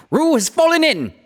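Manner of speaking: high, loud alarmed shout; alarmed cry. A narrator's reading. Rabbit cries out in alarm to everyone, high and loud. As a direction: cry out that Roo fell in. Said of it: "cried Rabbit".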